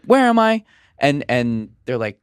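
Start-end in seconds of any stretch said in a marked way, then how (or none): 0.1-0.6 put-on high, loud frightened little girl; a lost child crying out in panic; high shrill child voice, scared; Charlie's voice. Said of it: "Charlie's voice".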